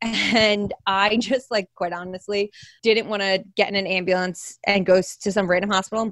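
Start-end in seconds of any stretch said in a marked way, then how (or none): none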